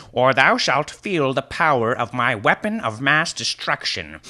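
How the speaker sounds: high, declaiming wizard; grandiose tirade. Acted. Wizard threatening the thief over mead, grandiose tirade. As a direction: build a pompous threat in mock-archaic style. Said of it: "His tirade".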